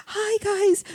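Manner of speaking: squeaky voice. Retold or imitated. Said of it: "You actually talk like this".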